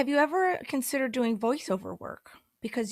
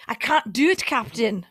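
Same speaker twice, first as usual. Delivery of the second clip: loud Scottish impression, pitched up; Groundskeeper Willie; 6 dB louder than usual. Recalling how a cartoon Scot talks, Scottish accent. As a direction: cry out in broad Scottish with rolled r. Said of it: "groundskeeper Willie".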